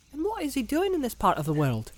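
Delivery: put-on high, eager young hobbit; young Pippin voice.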